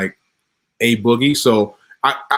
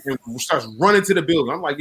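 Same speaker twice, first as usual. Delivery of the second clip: ordinary delivery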